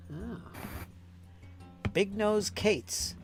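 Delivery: normal talking voice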